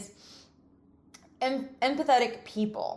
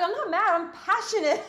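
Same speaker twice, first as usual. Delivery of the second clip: mock protest; 11 semitones higher, 4 dB louder than usual. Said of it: "I'm like".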